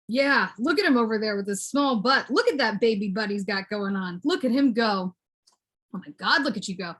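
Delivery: usual speaking voice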